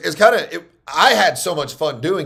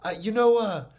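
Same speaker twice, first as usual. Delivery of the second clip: lightly imitated woman, pitched up; higher, hesitant name-dropping; imagined gal calling; 9 semitones higher than usual. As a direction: act out her casual phone chat in a higher voice. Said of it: "She's gonna call and be like".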